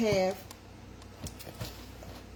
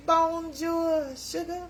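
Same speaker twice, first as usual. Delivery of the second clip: cheery French greeting; 10 semitones higher, much slower than usual. Shown as a demonstration.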